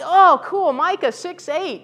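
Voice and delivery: thrilled preacher finding a favourite Bible verse; delighted. Retold or imitated. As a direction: squeal over a favourite verse. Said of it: "I'm like".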